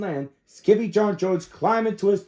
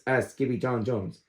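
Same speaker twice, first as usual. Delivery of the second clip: usual speaking voice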